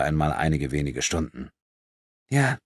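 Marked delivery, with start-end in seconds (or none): 2.3-2.6 higher-pitched, mildly rueful sigh. A narrator's reading. Thief accepting blame from her victim, rueful sigh. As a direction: sigh it in a raised girlish voice, resigned. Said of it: "seufzte Corinna".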